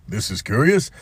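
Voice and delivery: usual speaking voice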